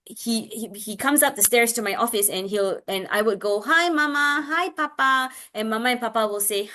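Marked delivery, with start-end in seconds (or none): 3.6-5.3 high singsong hello to parents; lilting, friendly greeting; modeled greeting. Retold or imitated. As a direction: re-enact greeting a family for a child. Re-enacts greeting child's parents.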